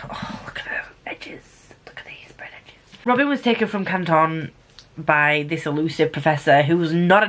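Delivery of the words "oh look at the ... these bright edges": very soft, voiceless whisper; awed whisper. Real, not acted. Reviewer showing off a book's sprayed edges, hushed whisper.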